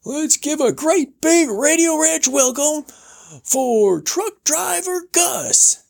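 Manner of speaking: high, slow showman welcome; radio host. Retold or imitated. Host imitated welcoming guest, high showman voice. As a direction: roll it out like a ranch host. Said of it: "and says".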